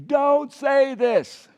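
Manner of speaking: very slow loud Don't say this. Shown as a demonstration. Preacher urging congregation to repeat, slow shout. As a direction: push it harder so they answer back. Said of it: "Repeat after me again".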